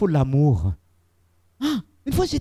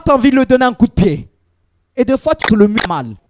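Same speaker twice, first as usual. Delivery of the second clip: loud, rising sermon delivery; fervent preacher calling out; preaching at full force; 6 semitones higher, 9 dB louder than usual. Acted. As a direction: drive it home to the congregation. Preaching to the congregation.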